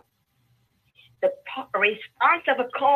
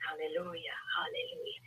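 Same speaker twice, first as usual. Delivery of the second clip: hushed hallelujah; 8 semitones lower, 14 dB quieter, faster than usual. Real, not acted. Preacher drops to hush.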